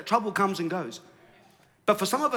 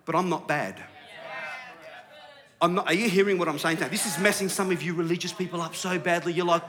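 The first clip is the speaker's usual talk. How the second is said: ordinary delivery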